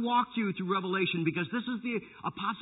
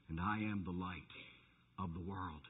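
much softer and lower, reverent; hushed solemn quote; 9 semitones lower, 11 dB quieter than usual. Acted. Preacher softly repeating Jesus to the congregation. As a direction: let the words fall to a hush.

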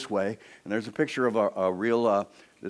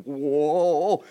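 louder amazed whoa at a huge sword; awed whoa; 6 semitones higher, 9 dB louder than usual. Acted. David lifting a monster sword, awed whoa. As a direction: gasp at the size of it. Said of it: "David's probably going".

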